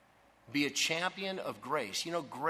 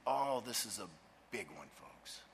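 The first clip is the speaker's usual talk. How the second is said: ordinary delivery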